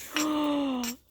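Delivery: quick high gasp of fright; mock startled gasp; sharp frightened gasp. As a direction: suck in air in sudden shock. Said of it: "and I'm like".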